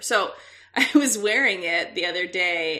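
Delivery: usual speaking voice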